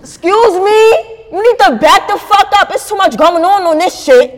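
loud, high-pitched confrontational outburst; acted New York rant at full volume; disrespectful New Yorker. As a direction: go off on a crowded bus passenger. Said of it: "disrespectful one".

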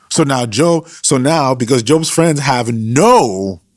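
ordinary talk, nothing marked

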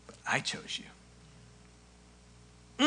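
breathy whisper of Jesus's words. Acted. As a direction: whisper it as the climax. Jesus's climactic line, whispered.

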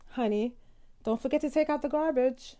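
high, sweet reminder; said nicely. Shown as a demonstration. Wife sweetly reminding husband to take out garbage. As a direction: sweeten the request like honey. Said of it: "just say it nicely".

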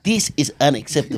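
put-on a light, haughty French accent; playful French accent, half-hearted; mock French accent. Host riffs on the critic in a mock French accent. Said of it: "do it in a French accent".

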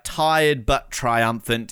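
slow quote. Retold or imitated. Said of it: "what does he say? Like".

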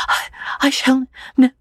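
high, stammering tearful quaver; broken, halting vow on the edge of sobs. A narrator's reading. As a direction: falter through it, voice high and trembling. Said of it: "quavered Lucy".

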